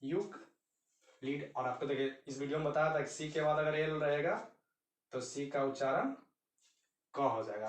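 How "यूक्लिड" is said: यूक्लिड said slowly, syllable by syllable: यू-क्लिड; slow model word. Shown as a demonstration. Teacher models यूक्लिड slowly for students. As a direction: draw out यूक्लिड for the class. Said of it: "यूक्लिड आया ना".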